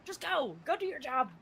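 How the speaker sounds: high-pitched, imitated exasperation, loud at first; exasperated urging. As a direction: urge them off, acting out the impatience. Retold exasperated order to deputies.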